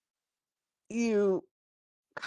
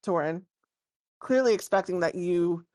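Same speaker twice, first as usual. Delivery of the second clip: ordinary delivery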